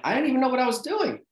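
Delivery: squeaky rushed disbelief; mock panic. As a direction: blurt it out like you've lost the plot. Panicked retold self-quote.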